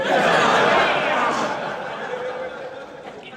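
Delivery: loud burst of laughter; laughing hard at his own joke; real laugh after a joke; big laugh. Real, not acted.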